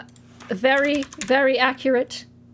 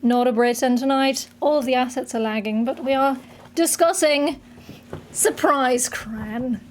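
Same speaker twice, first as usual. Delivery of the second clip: mock British accent. Hosts mock show in British accent.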